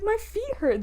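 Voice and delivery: high-pitched mock protest; imitating an indignant comeback; retold arguing. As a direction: whine back in your defence. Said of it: "got in, like, a mini argument".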